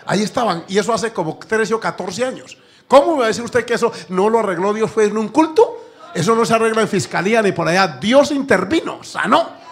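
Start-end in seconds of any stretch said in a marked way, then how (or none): 2.9-9.6 higher and louder, full-force preaching; triumphant sermon climax; fervent preaching. Acted. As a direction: preach it with rising fervor.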